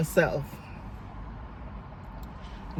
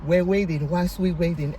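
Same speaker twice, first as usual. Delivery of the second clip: normal talking voice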